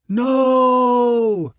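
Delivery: high, loud roar of the crowd. A narrator's reading. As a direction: shout it back as a mob. Crowd roars back.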